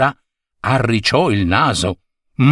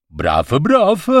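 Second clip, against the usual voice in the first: put-on higher, playful child's nonsense reply; 5 semitones higher than usual. A hint of a child answering his friend, playful. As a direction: answer like a giggling kid. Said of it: "rispose il secondo".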